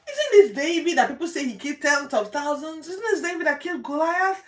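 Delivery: excited crowd. Retold or imitated. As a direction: exclaim it like a stunned crowd, laughing. Excited crowd recognizes David.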